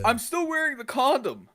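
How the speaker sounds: high, loud quoted confession; mock sheepish confession. Friend quotes roommate's confession, high and loud. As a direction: blurt it out.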